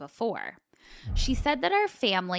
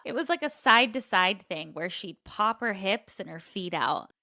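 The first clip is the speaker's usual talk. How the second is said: ordinary talk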